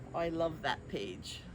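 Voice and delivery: usual speaking voice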